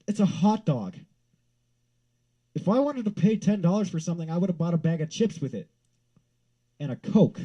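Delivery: high, loud indignation; outraged rant. Real, not acted. Ranting about ten dollars for a hot dog, outraged.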